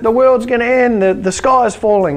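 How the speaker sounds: high, loud mock scream of alarm; mock panic. Speaker playing someone running down the street in fear, mock panic. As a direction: cry out in hammed-up terror.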